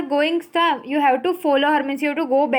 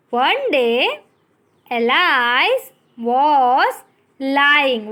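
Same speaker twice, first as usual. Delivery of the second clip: slow read-aloud; 2 semitones lower, much slower than usual. Shown as a demonstration. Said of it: "Today just read with me".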